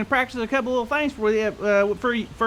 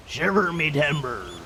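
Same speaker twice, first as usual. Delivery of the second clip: lower, slow pirate exclamation; pirate talk; 8 semitones lower, 4 dB quieter, slower than usual. Shown as a demonstration. [name] teaching kids pirate lingo, pirate growl. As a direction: growl it out like an old sea dog. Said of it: "to talk like a pirate".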